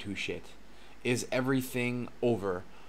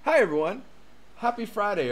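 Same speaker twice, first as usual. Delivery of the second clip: cheery greeting; 10 semitones higher, 6 dB louder than usual. Acted. Restarting stream with bright greeting.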